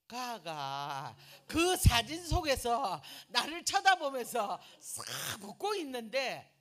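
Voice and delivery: loud, high, slow and dramatic; excited telling. Real, not acted. Telling the congregation about the photo, loud and dramatic. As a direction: stretch it out in disbelief.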